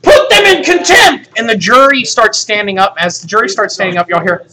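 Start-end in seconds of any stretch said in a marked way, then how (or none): 0.0-1.3 angry order. Acted. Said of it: "the deputy's like".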